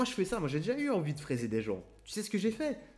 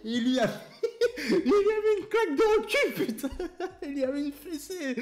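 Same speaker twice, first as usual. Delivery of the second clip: very high voice cracking up with laughter; helpless laughing; 8 dB louder than usual. Real, not acted. Storyteller recalling his father spanking the thief, laughing helplessly. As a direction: squeal it out through real laughter.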